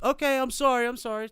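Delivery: imitated loud, high-pitched apology; shouted apology. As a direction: holler an apology across the water. Friend calls apology up to neighbour.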